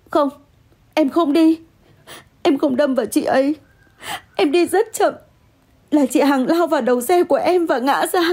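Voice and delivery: high-pitched, insistent denial; distressed protest. Acted. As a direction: protest your innocence in agitation. Protesting innocence to partner.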